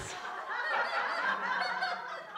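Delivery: high, loud burst of laughter; big laugh. Real, not acted. Laughs at the demand.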